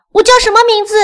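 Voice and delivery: high, loud excited dog; loud, high-pitched puppy cry; barking dog's shout. Acted. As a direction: call it out high like a yapping dog. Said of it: "狗汪汪汪地大叫".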